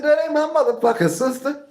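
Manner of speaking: high, loud and laughing; laughing outburst. Retold or imitated. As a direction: blurt it out through laughter. Blurting refusal, laughing.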